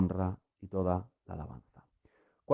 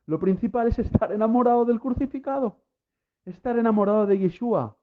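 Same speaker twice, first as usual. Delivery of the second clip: pitched far higher, climbing into fervent preaching; impassioned preaching climax; 9 semitones higher than usual. Real, not acted. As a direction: soar up with rising fervor. Sermon climax, rising fervor. (predicación apasionada y muy aguda)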